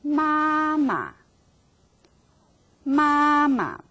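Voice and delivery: 妈妈 said high and level; held first tone. Shown as a demonstration. Teacher models 妈妈 for students, high level tone. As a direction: hold 妈妈 high and flat on both syllables. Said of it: "the first tone is so hard to hold".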